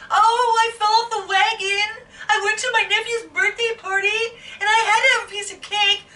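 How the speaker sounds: imitating a know-it-all pretending to suffer; mock whiny confession. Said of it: "be like".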